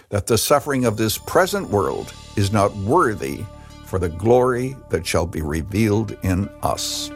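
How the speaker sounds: ordinary delivery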